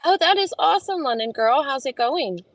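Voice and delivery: high, bright exclaim to a viewer; excited hello; delighted greeting. Real, not acted. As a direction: gush at a viewer's news.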